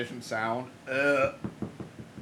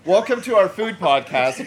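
laughing through a mock host welcome; laughing podcast intro; 4 semitones higher, 8 dB louder than usual. Real, not acted. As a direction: announce it while cracking up.

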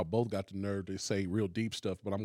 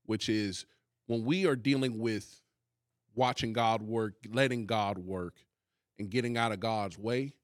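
usual speaking voice

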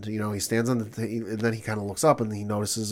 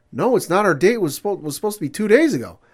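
louder and higher, exasperated; mock angry correction; 9 semitones higher, 7 dB louder than usual. Annoyed date correcting the man on the phone, loud and high. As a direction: snap back the real date. Girl corrects him angrily.

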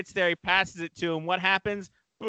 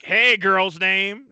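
slow, louder teasing call; mock taunt; 5 semitones higher, 7 dB louder, much slower than usual. Teammates jeering at a boy named Kelly, slow mock taunt. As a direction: drag it out like a schoolyard jeer.

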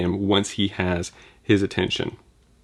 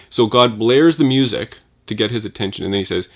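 ordinary talk, nothing marked

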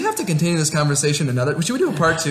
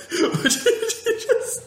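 laughing; 3 dB quieter, much slower than usual. Real, not acted. Laughing mid-question.

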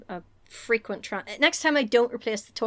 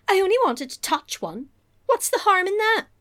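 high, rising mock plea; protesting innocence; 10 semitones higher, 5 dB louder than usual. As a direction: plead innocence, pitch climbing. Acts Lily protesting her innocence.

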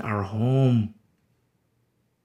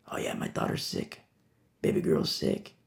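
whispered congregant; 12 semitones lower, 8 dB quieter than usual. Acted. Voiced congregant in house church.

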